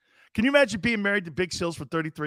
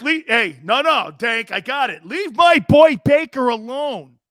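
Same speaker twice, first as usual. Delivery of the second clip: mock defending shout; 6 semitones higher, 5 dB louder than usual. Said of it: "Dank's like".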